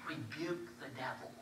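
soft hushed command; whispered. Retold or imitated. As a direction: barely breathe the words. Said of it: "I hear the Lord whisper".

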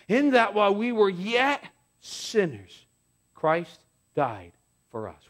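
slow, solemn recitation; scripture reading. Acted. Pastor reading Romans 5 to the congregation, slow and solemn. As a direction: draw the verse out slowly.

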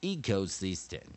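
loud, slow, measured classroom drill; emphatic teacher modelling each syllable for students to repeat; pronunciation drill recitation. Shown as a demonstration. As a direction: recite it piece by piece with long pauses.

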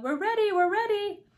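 high-pitched eager call. A narrator's reading. The king waves to the queen, calling out eagerly. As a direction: call it high across the room, held at the top. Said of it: "he called".

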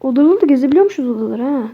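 ordinary delivery

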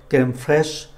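normal talking voice

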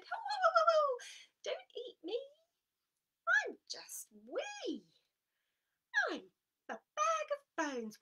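very high, squeaky pleading little goat; tiny goat voice. Acted.

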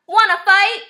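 high, loud picking a fight; cocky little bug calling out; grouchy ladybug. A narrator's reading. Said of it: "said the grouchy ladybug".

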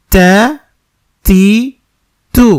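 তা তি তু each short with air. Shown as a demonstration. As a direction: run the three vowels on ত with a puff. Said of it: "বাতাস থাকবে". (জবর জের পেশ দিয়ে তা তি তু)

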